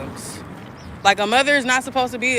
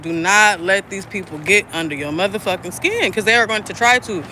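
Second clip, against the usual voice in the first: normal talking voice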